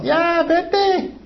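voz muy aguda y cambiada, ahuyentando; despido despectivo. Retold or imitated. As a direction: echa a la mujer con desprecio. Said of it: "mire qué malo que eran ellos". (discípulos despreciativos, voz aguda caricaturesca)

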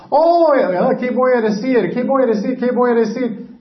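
agudo lamento de apuro, repetido con prisa; pánico fingido. Acted. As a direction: imita a un mentiroso atrapado, con la voz muy alta. Mentiroso sin saber qué decir. (pánico actuado de quien no sabe qué decir)

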